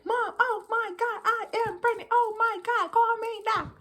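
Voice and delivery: high, panicked texting voice; retelling frantic texts to mom, high and panicky; freaking out. Retold or imitated. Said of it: "I sent those messages just, like, freaking out".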